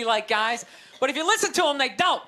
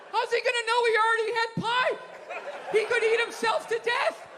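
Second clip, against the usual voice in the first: mock concern; 7 semitones higher than usual. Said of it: "going like".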